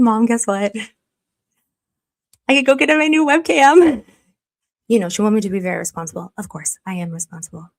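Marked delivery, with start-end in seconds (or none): 0.0-4.0 put-on excited kid to mom. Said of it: "I'm gonna be like".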